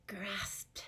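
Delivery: soft whisper of the vocab word. Shown as a demonstration. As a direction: breathe the word grasped. Repeats vocab word softly.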